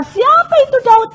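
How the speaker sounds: very high, mocking incredulous question; imitated scornful abuse. Nabal insulting David, imitated high-pitched scorn. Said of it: "Nabal balas maki-maki".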